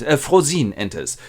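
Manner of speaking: ordinary delivery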